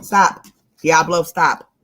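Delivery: loud, sharp no to the dog; firm scolding. Real, not acted. Owner scolding her puppy off the computer, loud and firm. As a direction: stop the dog cold.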